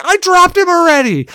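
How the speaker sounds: high, whiny protest; mock exasperated whine; retold exasperation. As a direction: complain it, high and outraged.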